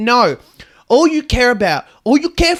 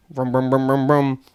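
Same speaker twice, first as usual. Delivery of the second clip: engine vroom noises; 8 semitones lower, 4 dB quieter than usual. Acted. Imitating bus engine driving off.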